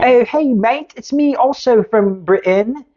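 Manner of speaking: high, chirpy fake British bloke; jokey put-on British accent; mock British accent. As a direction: ham up a cheery English lad. Mock Brit impersonating player.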